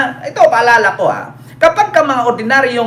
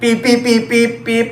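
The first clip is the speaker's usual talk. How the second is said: car horn. Retold or imitated. Imitating car horn again.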